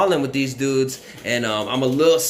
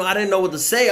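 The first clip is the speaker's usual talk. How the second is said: ordinary talk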